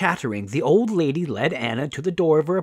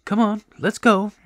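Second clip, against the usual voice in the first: lightly voiced helpful old lady; higher-pitched, chatty rescuer; kindly old woman; 6 semitones higher, 4 dB louder than usual. A narrator's reading.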